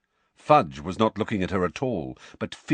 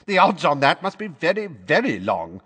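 scornful minister; 7 semitones higher, 4 dB louder than usual. A narrator's reading. Fudge scoffing on.

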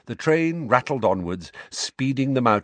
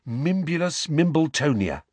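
proud; 5 semitones higher than usual. A narrator's reading. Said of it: "he said proudly".

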